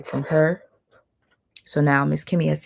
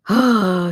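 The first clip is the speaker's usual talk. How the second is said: gasp; 4 semitones higher, 6 dB louder than usual. Real, not acted. Reacting to a chat comment.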